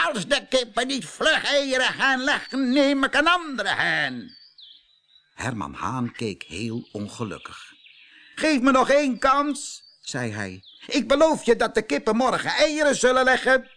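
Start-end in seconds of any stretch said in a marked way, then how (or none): 0.1-4.4 high, loud, angry farmer; shouting farmer. Acted. Farmer threatens the rooster from afar, shouting. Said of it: "tegen de haan schreeuwen". 8.3-9.8 high, loud begging; pleading rooster. Acted. Unhappy rooster begs the farmer, high and loud. 10.9-13.7 high, loud begging; pleading rooster. Acted. Unhappy rooster begs the farmer, high and loud.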